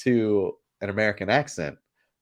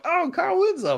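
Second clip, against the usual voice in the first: mock recognition.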